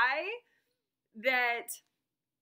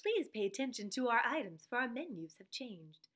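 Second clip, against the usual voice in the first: smooth, measured recorded operator; automated phone-menu voice; 4 dB quieter than usual. Acted. Recorded woman instructing callers, automated phone-menu voice. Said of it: "when you call, and she's like".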